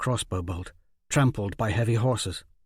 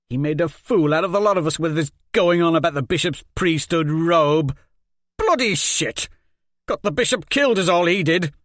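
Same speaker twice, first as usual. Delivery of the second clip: loud, higher scornful tirade; bitter rant; 5 semitones higher, 6 dB louder than usual. Acted. Jenko rants at the dead bishop, loud bitter tirade. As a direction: tear into the bishop.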